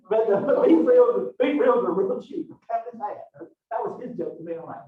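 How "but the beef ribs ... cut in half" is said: laughing. Real, not acted. Teacher retelling butcher's joke laughing.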